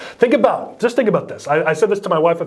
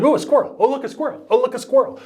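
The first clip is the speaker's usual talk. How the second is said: higher, excitable talking dog; distracted dog; 7 semitones higher, faster than usual. Candidate with no theme as the dog from Up, much higher. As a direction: blurt it out like a dog spotting a squirrel. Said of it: "it's like the dog from Up".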